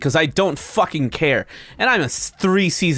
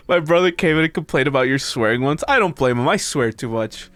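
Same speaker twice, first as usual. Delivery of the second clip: ordinary delivery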